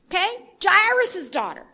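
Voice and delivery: ordinary talk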